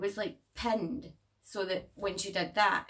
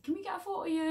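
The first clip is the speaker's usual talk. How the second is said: eager mum; 10 semitones higher, much faster than usual. Retold or imitated. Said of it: "they're like".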